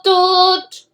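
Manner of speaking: distant toot. A narrator's reading.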